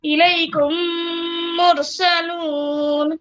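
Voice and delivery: الیکم مرسلون slow, the meem merged with a held nasal ghunna; ghunna recitation. Shown as a demonstration. Teacher repeating the correct recitation slowly. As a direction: join الیکم into مرسلون with one long humming meem. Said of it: "میم کو میم سے بدل کر غنہ کرنا ہے".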